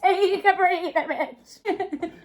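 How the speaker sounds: playful mock scolding voice for her hair ribbon; ribbon's voice. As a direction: give the fallen ribbon a cheeky high voice. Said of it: "My ribbon said".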